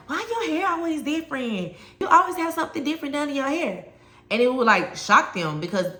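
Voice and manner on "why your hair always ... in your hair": much higher, mock-baffled kid voice; mimicking puzzled students. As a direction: play the kids pestering about her hair. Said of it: "they would always be like".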